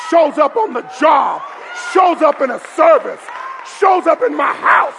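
shouted pulpit declaration; full-force preaching. Real, not acted. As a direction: fire up the congregation phrase by phrase.

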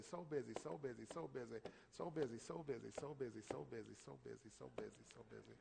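fading, mumbled mock excuse; a lightly voiced excuse-maker droning on and fading out; mock busy excuse. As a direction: trail off repeating so busy like a lame excuse.

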